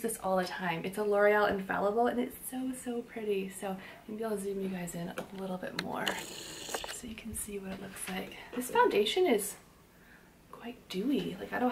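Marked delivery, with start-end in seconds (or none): 3.5-8.4 soft unvoiced whisper. Real, not acted. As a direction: whisper it as a quick aside. Aside while zooming the camera.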